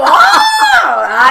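slow laughing shout of joy; loud delighted laughing cry; happy shriek. Real, not acted. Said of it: "es así como".

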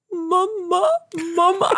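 put-on high little child calling mama; toddler pleading mama. Mom reenacting her daughter's pleading cries, high toddler voice. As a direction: plead like a baby reaching from the crib. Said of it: "with her little arm out and going".

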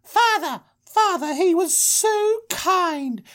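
very high, hammed-up girlish voice; gushing daughters. Daughters telling father about their rescuer, high mock gushing. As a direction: gush in a squeaky falsetto, drawing out the praise. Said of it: "and they're like".

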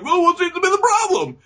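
very high, quick put-on voice; high-pitched hammy inquiry; mock dog catcher. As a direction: chirp it like an oblivious official.

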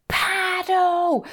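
high called-out order; mock urgent yell to paddle; urgent shout. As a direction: yell it at a surfer behind you. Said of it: "the boys were like".

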